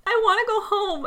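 a small sad creature begging to go home; pleading little donkey. Retold or imitated.

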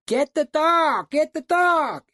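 high, drawn-out loud holler; yelling. Retold or imitated. Guide on the cliff to hunter below, yelling. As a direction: yell it far down, stretching each line. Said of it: "I started yelling at him".

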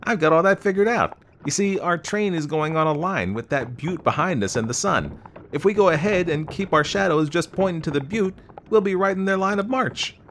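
put-on loud, high, confident young man; eager amateur plainsman. A narrator's reading. Young man shows off his plan to the girl, eager. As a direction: explain the plan eagerly, pitched well up.

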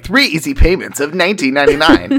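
fast, excited infomercial pitch; home shopping host. Retold or imitated. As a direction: sell it like a late-night TV ad. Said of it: "and it's, like".

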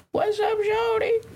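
high, slow pimp greeting; high swagger pimp. Pretend. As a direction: play a flashy pimp calling out. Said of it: "we got a grill in our mouth".

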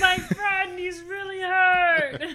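high, loud, slow creature wail; squeaky monster crying for help. Acted. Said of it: "The monster voice".

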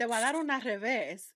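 lightly voiced scolding mother; a hint of a stern mom threatening; imitating mom. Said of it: "my mom used to call it".

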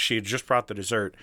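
normal talking voice

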